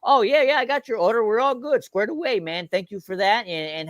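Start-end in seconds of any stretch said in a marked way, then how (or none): none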